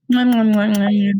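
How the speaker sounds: rapid string of mock smooches; exaggerated kissing noises. Describing the scene where Orion kisses, exaggerated kissing noises. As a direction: smack out playful kisses.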